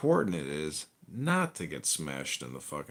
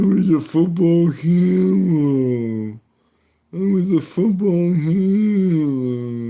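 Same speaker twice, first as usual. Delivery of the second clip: much higher, loud, slow dopey voice; dumb jock son; 11 semitones higher, 10 dB louder, much slower than usual. Acted. Comic voicing the football son calling his dad, high loud dopey voice. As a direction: drag it out like a dazed football kid.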